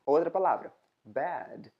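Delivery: ordinary delivery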